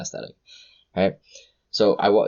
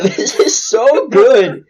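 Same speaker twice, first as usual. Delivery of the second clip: much higher, louder and slow; rapturous; 9 dB louder, much slower than usual. Real, not acted. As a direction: stretch out every word in bliss. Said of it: "already having an orgasm with your drink".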